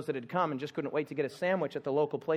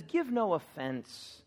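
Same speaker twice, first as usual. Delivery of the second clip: slow emphatic; 3 dB quieter, much slower than usual. Shown as a demonstration. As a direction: Give no offense drawn out very slowly, give leaned on. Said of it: "And then he says".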